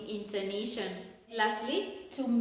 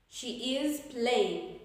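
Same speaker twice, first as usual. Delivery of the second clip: slow statement, "playing" stepping down; falling intonation; much slower than usual. Shown as a demonstration. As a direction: draw it out and drop on "playing". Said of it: "Falling intonation".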